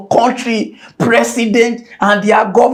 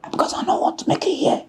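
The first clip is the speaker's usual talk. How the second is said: whisper; 6 semitones higher, 9 dB quieter, faster than usual. Real, not acted. Secret aside, hushed.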